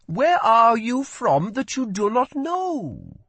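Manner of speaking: as if she must be joking; amazed. A narrator's reading. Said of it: "amazed laugh".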